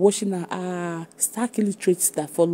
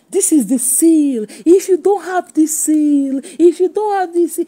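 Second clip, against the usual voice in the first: mocking imitation; 8 semitones higher, 7 dB louder than usual. Mimicking the woman who showed her paper, mocking imitation.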